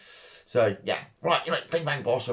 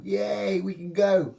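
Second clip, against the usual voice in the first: mock cheer; 9 semitones higher, 3 dB louder, much slower than usual. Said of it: "cheering that fishing reopened".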